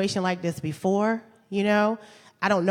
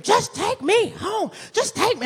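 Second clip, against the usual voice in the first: mock scream; 12 semitones higher, slower than usual. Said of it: "And he's just screaming".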